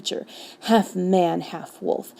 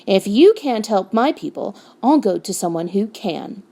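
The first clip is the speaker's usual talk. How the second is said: ordinary delivery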